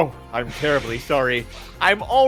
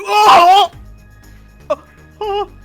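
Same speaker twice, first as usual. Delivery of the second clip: high, loud repeated cries; shocked shouts; 9 dB louder, much slower than usual. Acted. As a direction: cry out again and again in shock. Shouting exclamations.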